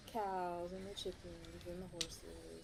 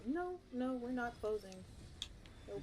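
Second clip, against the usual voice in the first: mock stubborn refusal; 6 semitones higher than usual. Mimicking school refusing snow day.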